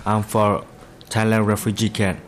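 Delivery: ordinary talk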